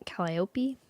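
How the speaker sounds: whispered Calliope practice; whispering. Shown as a demonstration. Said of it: "I've gotta say it over and over".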